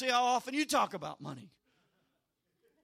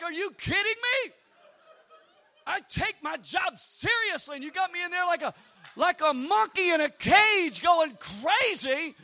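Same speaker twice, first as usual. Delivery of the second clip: loud, higher, indignant protest; offended outrage; 4 semitones higher, 6 dB louder than usual. Retold or imitated. Preacher protesting being made a monkey for entertainment, offended shouting. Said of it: "And then I'm offended".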